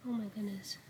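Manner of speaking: ordinary delivery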